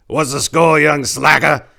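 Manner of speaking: put-on high, loud, cranky old man voice; mean old man. Old Apricot greeting Rio on the corner, cranky old-man needling. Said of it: "he said to Rio".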